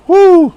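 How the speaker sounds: high, loud "woo"; overwhelmed whoop. Real, not acted. Preacher moved by Torah's love, overwhelmed whoop. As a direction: burst out in a praise whoop.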